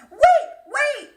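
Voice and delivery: high, loud frog calling after her; frog pleading. Acted. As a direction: shout after her, begging to be taken along. Frog calls after fleeing princess.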